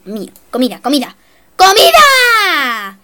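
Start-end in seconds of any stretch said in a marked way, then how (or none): 1.6-3.0 high, loud, drawn-out call; excited cry. Real, not acted. Gamer spotting food, calling out excitedly. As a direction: stretch it out in delight.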